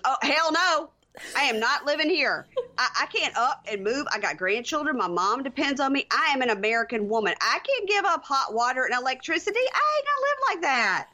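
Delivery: high-pitched, outraged sass rising to a peak; indignant refusal. Acted. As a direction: act out a woman flatly refusing, voice climbing. Acted outraged refusal of a reality star.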